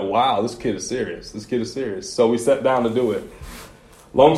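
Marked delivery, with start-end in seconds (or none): 1.3-2.1 softer, dropped to himself; quieter repeat. Retold or imitated. Dad repeating to himself about his daughter, softer. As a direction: echo it under the breath. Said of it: "so I'm like".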